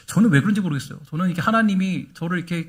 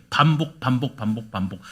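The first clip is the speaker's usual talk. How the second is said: each word softer and lower, dying away; fading repetition; 7 semitones lower, 6 dB quieter than usual. Acted. Preacher repeating fading into a hush. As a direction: let the word fade out each time.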